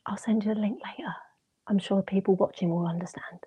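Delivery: quiet whisper. Real, not acted. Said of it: "whispering during the prank".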